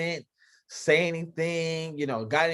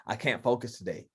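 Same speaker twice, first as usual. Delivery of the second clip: softer, lower mock of a worker calling off; mock soft excuse; 7 semitones lower, 6 dB quieter than usual. Boss on a call mimics workers calling off, softer and lower. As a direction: murmur the excuses as if imitating a fragile coworker. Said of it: "where it's".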